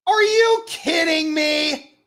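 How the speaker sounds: loud, slow, high indignant yell; real frustrated shout at the screen; outraged disbelief. Real, not acted. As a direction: yell at the bad call in disbelief. Outraged at penalty call.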